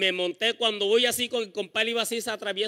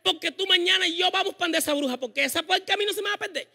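higher, insistent mother's voice; determined mother; 7 semitones higher than usual. Retold or imitated. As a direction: vow to go to the witch. Y comienza. (voz aguda y decidida de la mamá)

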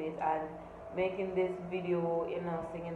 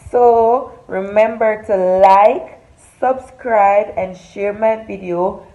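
loud, punchy host greeting to the camera; upbeat vlog intro; 4 semitones higher, 19 dB louder than usual. Acted. Vlogger welcoming her followers before the story, loud upbeat host voice. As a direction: hype up your followers like a show host.

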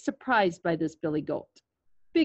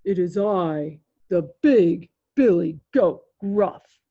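big tough goat, loud and slow; loud, deliberate tough-guy goat; toughest voice; 6 dB louder, slower than usual. A narrator's reading. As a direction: boom each word as the biggest goat. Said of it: "in his toughest voice".

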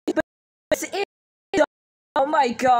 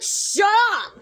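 high, sudden yell; exasperated shout; 6 semitones higher than usual. Acted. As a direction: cut the taunt off with a yell. Taunted friend cuts off chant.

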